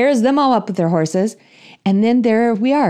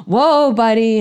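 higher, drawn-out slow whoa; slow the pony down, nervously; slow, anxious coaxing of a horse; 5 semitones higher, much slower than usual. Retold or imitated. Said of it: "And I'm like, gasps".

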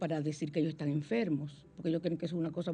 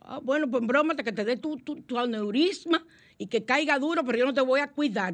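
mock scolding; 6 semitones higher than usual. Wife scolding stubborn husband.

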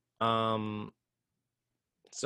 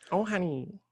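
life advice voice; 6 semitones higher than usual. Pretend. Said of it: "to speak in that voice".

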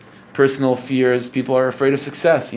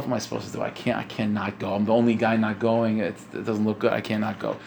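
talking to himself; 4 semitones lower, 6 dB quieter than usual. Retold or imitated. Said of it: "he says to himself".

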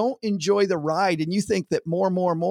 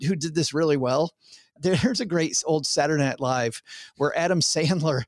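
ordinary delivery

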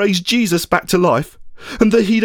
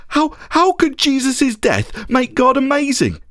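high, slow, stammered incredulous question; grief-stricken incredulity, pitched high; appalled disbelief; 8 semitones higher than usual. Acted. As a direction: question it in pained disbelief, voice lifted. Thomas appalled, narrated disbelief.

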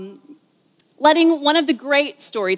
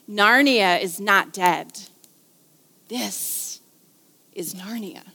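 slow, then dropping to a whisper on the last words; hushed reverence; 2 semitones lower, slower than usual. Acted. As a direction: let it fall to an awed whisper. Climactic line, dropping to whisper.

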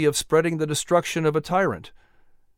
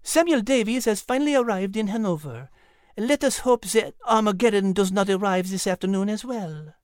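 voiced character; 5 semitones higher than usual. Character speaks, far voice.

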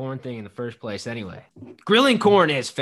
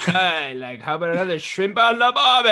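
mock Australian accent; 5 semitones higher, 5 dB louder than usual. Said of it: "ignorant American and be like".

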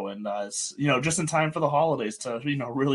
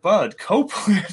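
voice crack; 7 semitones higher, 6 dB louder than usual. Real, not acted. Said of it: "Voice crack, throat clear".

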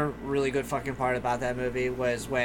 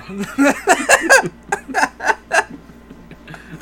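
loud burst of laughter; 10 dB louder than usual. Real, not acted. Big laugh after joke.

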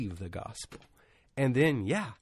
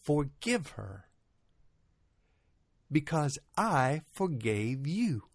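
lightly voiced divine command, slow and deliberate; a measured, weighty retold pronouncement; Holy Spirit; 3 semitones higher, slower than usual. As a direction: speak slowly and a little higher, with long pauses. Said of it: "the voice spoke to me and said".

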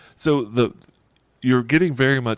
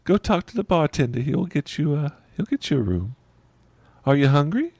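flighty waitress voice; 8 semitones higher than usual. Acted.

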